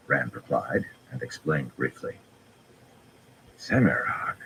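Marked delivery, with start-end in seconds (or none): 3.6-4.4 breathed in disbelief; incredulous whisper. A narrator's reading. Bashir stunned on recognizing the prisoner, whispering. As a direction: whisper the name in shock. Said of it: "Bashir muttered incredulously".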